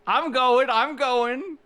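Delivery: exasperated protest. Acted. Rushed by other players.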